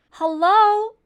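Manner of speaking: high, loud worried call; loud anxious call into the mess. A narrator's reading. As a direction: call out searching. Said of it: "Abel called".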